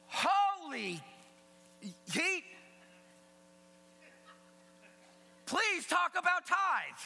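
high, loud comic pleading; mock shocked outburst. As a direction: blurt it out as a panicked listener. Acts out shocked listener's reaction.

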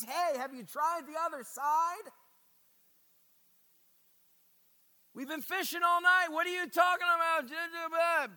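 loud, high, exasperated fishermen; annoyed fishermen. Retold or imitated. Tired fishermen snapping at a stranger on the shore, loud. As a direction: scoff at the stranger's advice, loud. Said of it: "meeting a stranger".

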